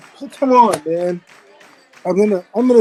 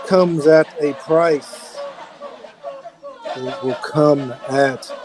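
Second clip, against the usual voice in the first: ominous story narration; 5 semitones lower, 4 dB quieter, slower than usual. Acted. Dramatic storyteller voice.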